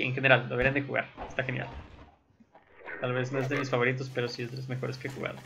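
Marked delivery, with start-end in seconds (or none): none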